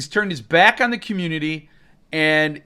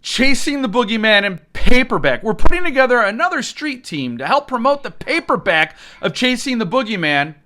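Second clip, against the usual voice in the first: mock promo read; 5 semitones higher, 4 dB louder than usual. Reading the newsletter aloud mockingly.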